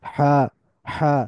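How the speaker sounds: হা squeezed in the middle of the throat; big Arabic হা, breath partly blocked; heavy constricted হা. Shown as a demonstration. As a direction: give the h in হা weight and friction. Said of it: "ভার চলে আসতেছে".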